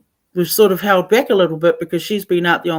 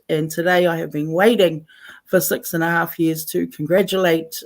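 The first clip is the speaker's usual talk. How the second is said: ordinary talk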